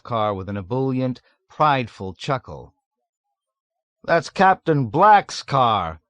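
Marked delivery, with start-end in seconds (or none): 4.0-6.0 loud, high, slow boast; jubilant. A narrator's reading. Chief gleefully boasting about the stolen car, loud. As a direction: gloat over it gleefully. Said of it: "informed them jubilantly".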